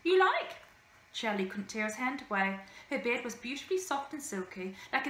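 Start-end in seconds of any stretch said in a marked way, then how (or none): 0.0-0.5 bearded lady voice. A narrator's reading. Bearded lady offers her beard.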